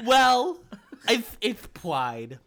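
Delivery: high, laughing cartoon king; King Candy. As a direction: giggle through it in character. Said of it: "I sound like the Mad Hatter".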